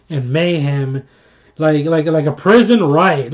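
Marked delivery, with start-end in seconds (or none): none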